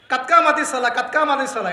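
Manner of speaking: "কাতকা মাতি সালা" rattled off with the words clipped and merged; garbled iqamah. Retold or imitated. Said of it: "একামত শুনলে মনে হয়". ("কাতকা মাতি সালা" বলে বিকৃত একামতের নকল)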